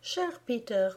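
plain reading voice

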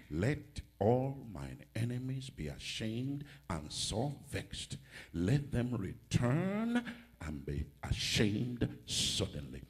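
weighty recitation, dropping low at the end; scripture reading. Real, not acted. Reads verse ten slowly.

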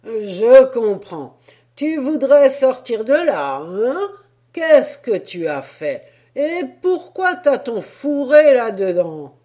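loud, hearty sailor talking to a donkey. Acted. Sailor addressing the penned donkey, loud hearty voice. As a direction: talk like a jolly sailor.

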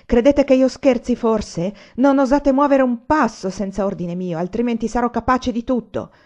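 loud, threatening rage; delirious fury. A narrator's reading. Anania forbids any step without his order, delirious threat. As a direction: threaten them in a raving fury. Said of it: "egli disse come in delirio".

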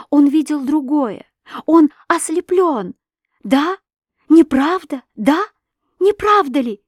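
high, agitated, insistent questions; distraught pleading. A narrator's reading. Wife begs the girl to deny it, distraught pleading. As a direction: beg urgently for reassurance, pitch climbing.

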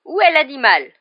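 high, loud quavering demand. A narrator's reading. Commission member demanding the missing animal, loud and quavering. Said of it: "dit la voix chevrotante".

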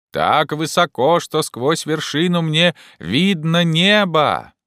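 slow, high, carrying call. Acted. Jupiter reports seeing sky, calling down. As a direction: holler it from a great height. Climber answers from the treetop.

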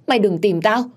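high, loud panicked begging; frightened plea. A narrator's reading. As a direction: beg the ghost to leave her alone. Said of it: "ôm đầu và la hét". (van xin hoảng sợ)

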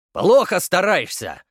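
loud, slow stern comeback; stern coach retort. A narrator's reading. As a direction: snap back like a strict coach. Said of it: "парировал мускулистый тренер".

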